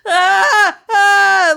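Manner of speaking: high, loud imitated cries of pain; imitated pained scream. Friend pulling off his sock over a crushed toe, imitated screaming. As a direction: mimic a friend yelping as his sock comes off. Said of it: "like, screaming".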